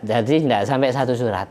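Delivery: ordinary delivery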